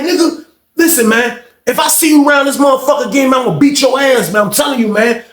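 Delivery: high, heated warning; retold angry threat. Inmate warning a younger man to stay away, heated threat.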